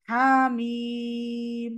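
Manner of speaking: হামিম drawn out very slowly, sung like recitation; recitation demo. Shown as a demonstration. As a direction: hold হামিম long and even. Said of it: "আপনারা practice করে নিয়েন".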